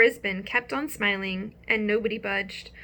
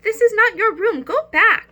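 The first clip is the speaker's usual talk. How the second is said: high, loud little squeak of alarm; squeaky hamster; 12 semitones higher, 10 dB louder than usual. Acted. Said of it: "I squeaked".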